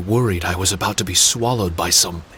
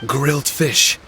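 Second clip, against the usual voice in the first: a startled, higher questioning echo; surprised echo; 6 semitones higher than usual. Acted. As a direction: catch the words as if they struck a memory. Startled echo of Paimon's words.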